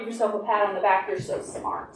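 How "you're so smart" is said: whispered praise. Real, not acted. Teacher praising children softly.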